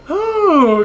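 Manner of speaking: high, loud whew; high exhaled whoop of relief. Real, not acted. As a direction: let out a big high "whew". Relief after roller coaster game.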